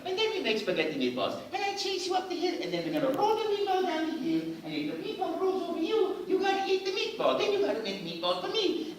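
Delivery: very high, excited cartoon Italian voice; Mario. Actor improvising for the audition camera, high playful Italian character voice. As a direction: squeal playfully in a young Italian accent. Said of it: "I just kept going".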